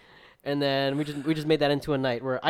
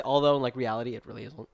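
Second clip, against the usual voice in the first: normal talking voice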